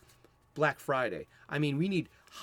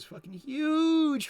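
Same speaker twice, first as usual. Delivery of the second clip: "huge" stretched out long, high and loud; loud, soaring hit on "huge"; emphatic HUGE; 13 semitones higher, 5 dB louder, much slower than usual. Real, not acted. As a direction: blow up the word "huge" with a big drawn-out vowel. Exaggerates how popular it was.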